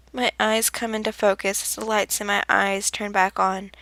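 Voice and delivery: plain reading voice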